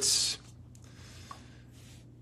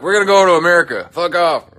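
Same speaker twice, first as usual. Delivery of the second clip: fast, louder mock hillbilly; hillbilly cousins; 7 semitones higher, 9 dB louder than usual. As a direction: rattle it off like defiant cousins. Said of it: "We're like".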